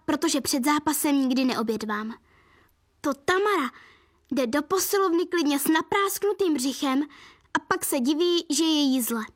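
plain reading voice

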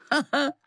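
krátký pobavený smích; zasměj se krátce za kluka; hraný smích. Acted. Lase se směje dívce. (krátké škádlivé zasmání)

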